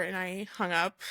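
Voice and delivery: ordinary talk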